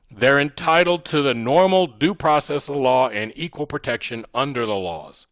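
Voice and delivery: ordinary delivery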